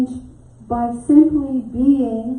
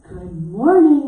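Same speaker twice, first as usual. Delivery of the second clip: louder, slow and sunny; sunny greeting; 8 dB louder, slower than usual. Retold or imitated. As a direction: greet him all sunshine. Greeting the grouchy clerk.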